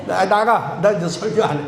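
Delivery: ordinary delivery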